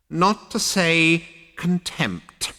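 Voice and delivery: slow, sneering ancient voice; contemptuous old ghost. Acted. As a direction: draw out the contempt.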